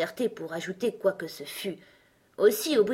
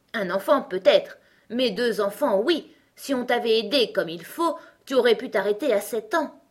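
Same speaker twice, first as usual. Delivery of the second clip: louder, higher know-it-all character voice; opinionated Gros Coco; 5 semitones higher, 6 dB louder than usual. Acted. Gros Coco corrects Alice about growing up, opinionated. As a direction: correct the child with emphatic certainty.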